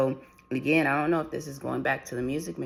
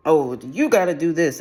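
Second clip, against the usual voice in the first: louder, higher mock bossy order; demanding boss; 4 semitones higher, 9 dB louder than usual. Imitating a boss ordering staff around, demanding. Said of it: "It's demanding".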